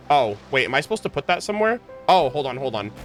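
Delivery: normal talking voice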